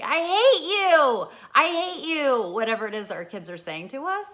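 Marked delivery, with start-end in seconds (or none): 0.0-2.5 put-on high, loud, slow teenage yell of I hate you; hammed-up furious daughter shouting; teen screaming. Daughter screaming at her mother, a loud, high, drawn-out yell. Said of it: "it's like".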